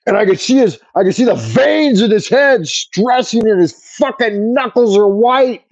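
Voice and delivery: ordinary delivery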